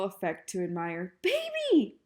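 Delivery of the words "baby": high, loud furious scream; outraged scream from the garden. A narrator's reading. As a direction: shriek her sister's name in fury. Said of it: "screamed Eugenia".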